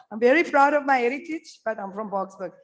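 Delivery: usual speaking voice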